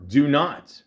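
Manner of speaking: "not" hit loud like bold caps. Retold or imitated. Reading her profile warning to men, with "not" hit loud. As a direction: slam "not" as though it were typed in capitals. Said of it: "in bold caps".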